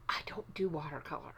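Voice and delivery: "I don't do watercolor" whispered fast and softly, like a confession. Real, not acted. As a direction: whisper it as a secret aside. Quietly confessing dislike of watercolor.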